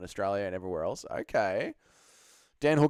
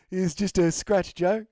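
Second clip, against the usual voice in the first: put-on higher, slow New Zealand accent shrug-off; Dan Hooker accent; 7 semitones higher, 5 dB louder, slower than usual. Fighter telling Joe it's a scratch, New Zealand accent. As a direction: brush off the injury like a Kiwi. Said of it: "Can you do a Dan Hooker New Zealand accent?".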